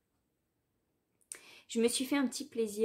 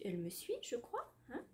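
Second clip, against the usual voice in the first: ordinary talk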